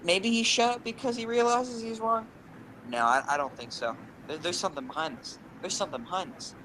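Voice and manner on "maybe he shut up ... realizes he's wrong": wondering thought. Retold or imitated. Voicing his thought while scared.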